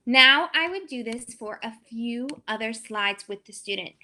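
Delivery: normal talking voice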